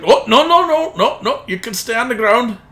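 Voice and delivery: high, rapid-fire protest from the driver; objecting driver. Acted. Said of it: "but he's like".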